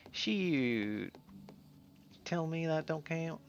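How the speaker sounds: playful put-on Southern twang; Southern accent. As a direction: play a mildly indignant Southern cowpoke. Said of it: "drop my southern accent".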